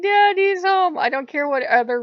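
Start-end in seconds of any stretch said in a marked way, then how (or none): 0.0-1.0 high, loud squeal of delight; joyful cry. Retold or imitated. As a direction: cry it out like an overjoyed child greeting a parent. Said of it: "You start crying tears of joy".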